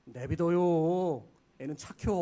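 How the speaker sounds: slow, drawn-out Chungcheong elder voice; slow Chungcheong insult in disguise; Chungcheong dialect. Retold or imitated. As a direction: drawl it like a sly Chungcheong elder. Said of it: "충청도 어른들의 최고 심한 욕".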